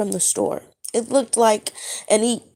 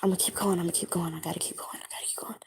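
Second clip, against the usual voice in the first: whispering to self; 3 semitones lower, 12 dB quieter, much faster than usual. Real, not acted. Teller urging herself to keep telling the dream, in a fading whisper. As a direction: whisper that fades away to almost nothing.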